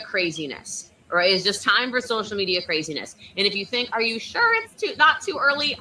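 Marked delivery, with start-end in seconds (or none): 3.9-5.8 high-pitched sceptical question; mock doubt. Coach voicing a listener unsure about starting early, mock doubt.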